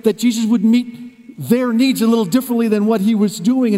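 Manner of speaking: normal talking voice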